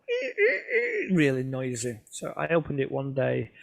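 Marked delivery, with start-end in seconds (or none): 0.1-1.1 high squeaking door imitation; squeaky door. The pull door of the hide squeaks as he opens it, high squeal. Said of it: "it went".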